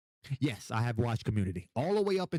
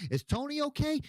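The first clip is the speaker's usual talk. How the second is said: higher mock question; imitating people asking; 13 semitones higher than usual. Strangers asking about Tony, higher mocking voice. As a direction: ask it pitched up, mocking. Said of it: "they say".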